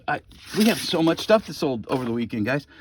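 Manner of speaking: normal talking voice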